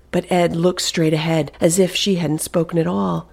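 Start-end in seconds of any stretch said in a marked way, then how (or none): none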